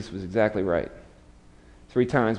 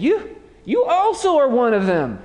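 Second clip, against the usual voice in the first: high, pointed accusation; accusing bystander; 11 semitones higher, 4 dB louder than usual. Acted. Bystander accusing Peter at the fire, high and pointed. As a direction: jab the finger at Peter, higher and louder.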